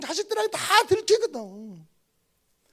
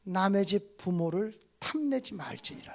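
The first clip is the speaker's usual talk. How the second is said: mock scripture; 10 semitones lower, 8 dB quieter, slower than usual. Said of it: "그 조에서는".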